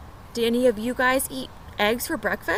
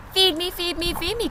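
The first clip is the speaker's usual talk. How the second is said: hungry duckling; 7 semitones higher than usual. Acted. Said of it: "cried the hungry baby duck".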